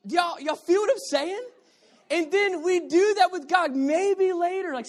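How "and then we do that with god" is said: ordinary delivery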